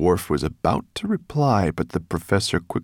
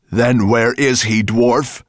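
loud, raised monster voice; bellow; 6 semitones higher, 8 dB louder, slower than usual. A narrator's reading. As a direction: bellow a demand at the dwarf. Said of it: "bellowed Nettlebrand".

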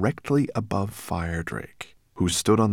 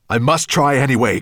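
raised, higher voice, determined; crying out; 6 semitones higher, 8 dB louder than usual. A narrator's reading. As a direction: call out with urgent resolve. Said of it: "cried the dragon".

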